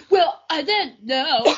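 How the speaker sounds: a hint of a slow, sheepish boyfriend; slow innocent boyfriend voice. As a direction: drawl it out innocently. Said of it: "He's like".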